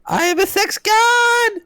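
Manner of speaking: very high, strained boast; hammed-up voice acting. Player derailing the game for co-host, hammy high boast. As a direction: belt it out in a silly high voice. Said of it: "I should go into voice acting".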